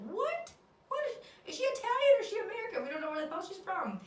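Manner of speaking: high, loud, fast mock confusion; confused locals. Landlords baffled by her Italian, high fast squeal. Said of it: "And they're like".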